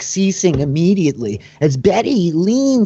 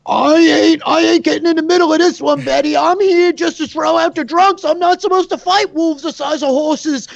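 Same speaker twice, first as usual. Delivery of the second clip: higher, quick, whiny backing-off voice; reluctant guard; 13 semitones higher than usual. Acted. Tavern guard refusing Betty's order, quick and whiny. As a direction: plead your way out of the fight. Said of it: "who turns like".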